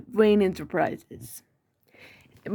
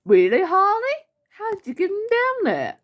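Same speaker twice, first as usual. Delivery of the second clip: very high, incredulous villain; high-pitched, theatrical disbelief; Joker; 12 semitones higher, 3 dB louder than usual. Acted. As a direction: question her in a squealing high voice. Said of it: "Let me try again with the Joker".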